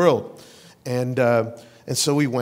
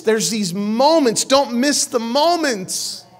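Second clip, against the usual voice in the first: loud, high preaching build; rising sermon fervor; full-force preaching; 7 semitones higher, 5 dB louder than usual. Acted. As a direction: drive the point home to the congregation.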